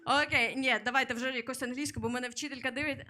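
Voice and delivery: ordinary talk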